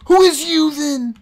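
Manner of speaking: high loud retort; indignant shout. Real, not acted. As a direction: fire it back indignantly. Arguing back loudly.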